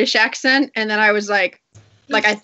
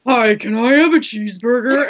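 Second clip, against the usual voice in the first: louder put-on food-ordering voice; brother ordering food; 6 dB louder than usual. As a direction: imitate a brother switching into his ordering voice. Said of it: "sound like my brother when he orders food".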